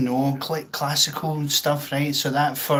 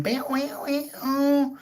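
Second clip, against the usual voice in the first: string bend noise; 12 semitones higher than usual. Shown as a demonstration. Showing viewers how bent strings sound, high imitated wail. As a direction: sing the wobble of a bent string.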